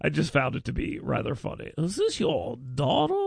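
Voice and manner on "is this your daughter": lightly put-on old man voice, rising at the end; a hint of a doddering politician asking; host mocking the man's question; imitating Jim Ziegler. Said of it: "The voice really adds to it".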